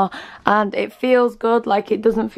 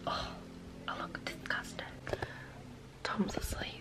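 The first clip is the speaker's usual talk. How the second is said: whisper; 2 semitones lower, 17 dB quieter than usual. Real, not acted. Tom's asleep.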